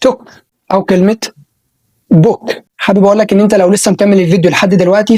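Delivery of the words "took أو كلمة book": "took" and "book" with a short oo; short oo model. Shown as a demonstration. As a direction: say them clipped. (صوت أو قصير)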